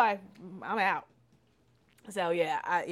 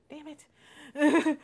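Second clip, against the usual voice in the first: higher, louder Damn it; frustrated; 8 semitones higher, 7 dB louder than usual. Retold or imitated. Realising she's mad at him, frustrated and loud. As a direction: catch yourself in annoyance.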